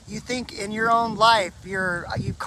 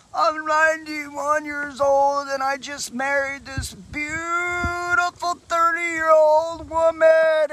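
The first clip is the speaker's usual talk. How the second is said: put-on high, drawn-out wail of an old man; mock crying; 7 semitones higher, much slower than usual. Old pastor explaining his tears to a pastor, crying theatrically. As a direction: bawl it slowly in a high, put-on voice. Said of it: "he starts crying again".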